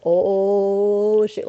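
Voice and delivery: long, loud uh-oh reaction; dismayed onlooker. Retold or imitated. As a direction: imitate a bystander seeing trouble coming.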